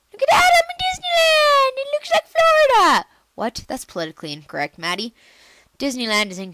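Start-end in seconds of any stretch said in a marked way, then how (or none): 0.1-3.1 very high, loud delight; thrilled squeal. Real, not acted. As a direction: gush like it's a dream come true. Kid thrilled seeing Disneyland.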